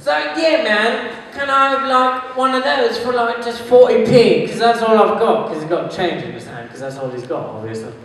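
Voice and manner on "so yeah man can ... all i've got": higher-pitched tramp wheedling for a bargain; tramp cutting a deal. Acted. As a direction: plead casually as a tramp haggling. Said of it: "and he's like".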